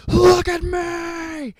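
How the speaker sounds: high, drawn-out furious scream; very loud, hammed-up shriek; flipping out. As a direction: scream it as if losing control. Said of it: "Dorian flips out on Basil".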